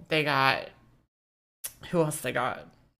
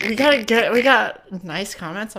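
excited; 5 semitones higher, 7 dB louder than usual. Real, not acted. Excited by nice comments.

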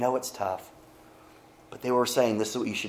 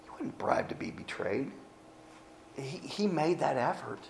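whispered, pointed aside; 3 semitones higher, 9 dB quieter than usual. Real, not acted. Preacher stressing Judas chose betrayal, whispered. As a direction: lean in and whisper it.

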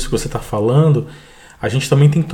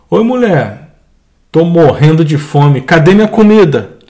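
rude husband; 2 semitones higher, 7 dB louder than usual. Acted. Hungry husband rudely demanding dinner from his wife, loud. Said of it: "Olha o que o marido fala pra mulher".

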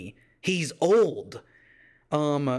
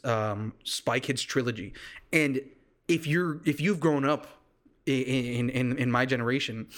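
normal talking voice

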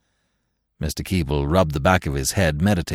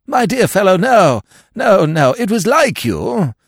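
higher and louder, hearty bluster; hearty old lord waving off the offer; vague heartiness; 13 semitones higher, 7 dB louder than usual. A narrator's reading. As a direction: brush him off with booming cheer. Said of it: "said his lordship with a vague heartiness".

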